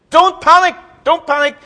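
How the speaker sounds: high, loud, flustered cry of don't panic; Corporal Jones panicking. Retold or imitated. Preacher mimics Dad's Army's Corporal Jones, panicky high shout. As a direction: play the frantic old soldier yelling not to panic. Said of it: "as he panicked".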